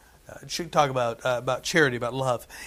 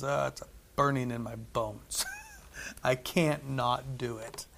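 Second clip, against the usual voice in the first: resigned Jeremiah; 3 semitones lower, 10 dB quieter than usual. Retold or imitated. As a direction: give in quietly to the burning call. Jeremiah resigned to preach again.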